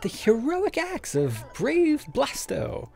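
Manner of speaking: calm them down in a squeaky voice; high-pitched peacemaker. Acted. Voicing peacemaker character.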